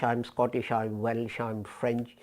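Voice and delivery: ordinary talk, nothing marked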